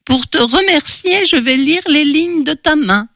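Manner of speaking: put-on high-pitched old crone; old beggar woman. A narrator's reading. Old beggar offering palm reading for a coin, high crone voice. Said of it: "la tendit à la vieille".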